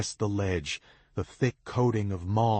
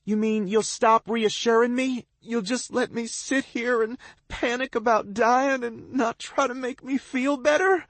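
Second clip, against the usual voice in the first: very high, sobbing panic; terrified girl sobbing up at him; near-screaming sobs; 4 dB louder than usual. A narrator's reading. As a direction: break down in terror. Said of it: "She's nearly screaming ... punctuated by sobs".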